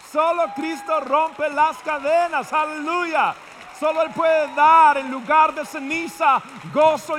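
loud, high, fervent shouted praise; preaching at full force. Real, not acted. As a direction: preach it out with full fire, drawn out. Pastor bursts into fervent preaching. (predicando a todo pulmón, muy alto y fuerte)

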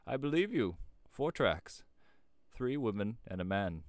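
loud, emphatic rustic; high, forceful country voice; old shepherd. A narrator's reading. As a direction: pronounce it like an ominous old-timer.

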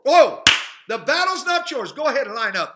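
sudden loud high cry; exclamation. Real, not acted. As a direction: burst out with joy at the crowd. Preacher bursts out exclaiming.

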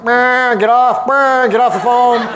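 high and loud, buzzing like a timer; alarm voice. Retold or imitated. Coach imitating the alarm cutting off a trainee, high loud buzz. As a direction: blare it like an alarm ordering them off. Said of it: "I put this big alarm".

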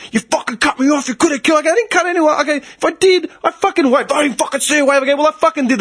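high-pitched, rapid-fire back and forth; retold furious argument; acted heated quarrel. Acted roadside quarrel with raging driver.

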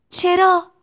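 put-on high, loud child's yell; shouted question. A narrator's reading. Tistu asking the owner why, high shout. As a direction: fling the question out loudly. Shouted exchange over factory noise.

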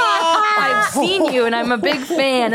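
high, slow, drawn-out excitement; gushing fan imitation. Stranger approaching a comedian on the street, gushing. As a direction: gush like a starstruck stranger.

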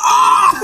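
very loud, high scream; piercing howl of pain, half laughing; real pain scream. Real, not acted. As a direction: scream out from the burning eye. Eye hurts more.

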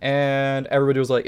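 ordinary delivery

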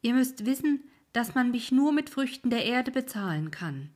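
firm terms from the boatman; insistent old ferryman. Acted. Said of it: "ferryman states his price".

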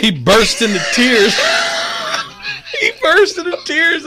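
high and strained by real laughter; real teary laughter; laughing to tears. Real, not acted. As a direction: push the words out while laughing. Laughing while telling the story.

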